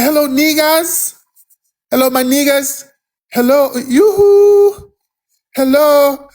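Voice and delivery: sucking up with a sweet high hello; fawning high-pitched hello, no bass in the voice; humble meek greeting. Acted. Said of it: "they voice get all humble".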